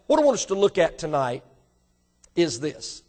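normal talking voice

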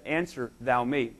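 soft, slow, grave command; slow solemn command. Retold or imitated. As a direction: lay it down slowly and low. God demanding answer.

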